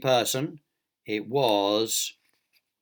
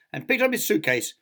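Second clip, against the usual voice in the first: plain reading voice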